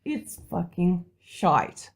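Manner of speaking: normal talking voice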